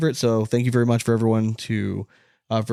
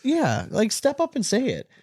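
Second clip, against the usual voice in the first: higher, fired-up challenge; exasperated; 12 semitones higher than usual. Real, not acted. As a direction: dare them to step up, pitched high. Calling out burner account poster.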